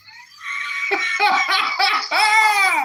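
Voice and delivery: high, loud mocking cackle; loud scornful burst of laughter. Real, not acted. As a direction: laugh at them in triumph.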